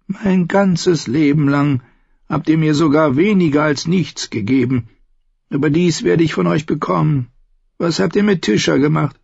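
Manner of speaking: Tyrion's voice. Acted.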